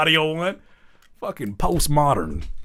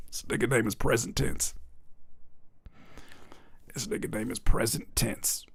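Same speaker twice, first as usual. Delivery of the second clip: hushed joke; 3 semitones lower, 12 dB quieter than usual. Acted. Hushed joking name repeat.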